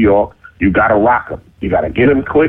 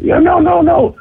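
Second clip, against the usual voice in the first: high, retold excited protest; urgent insisting. Friends outside persuading him it's true, urgent insisting.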